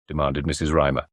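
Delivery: ordinary delivery, nothing marked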